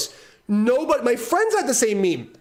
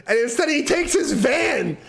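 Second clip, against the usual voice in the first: normal talking voice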